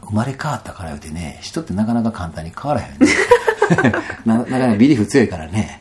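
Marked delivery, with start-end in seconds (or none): 3.1-4.2 loud high laugh; high burst of laughter. Real, not acted. Laughing at how people never change, high laugh. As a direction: laugh out at his own joke.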